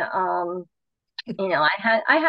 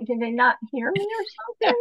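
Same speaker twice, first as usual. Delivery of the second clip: incredulous; 10 semitones higher than usual. Retold or imitated. Her disbelief at the girls.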